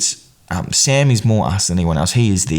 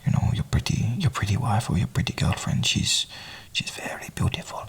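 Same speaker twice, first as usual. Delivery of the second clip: much softer, half-whispered quote; hushed acted compliment; 10 dB quieter than usual. Imagined remark about your pretty wife, hushed. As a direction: murmur it very softly, as if confiding.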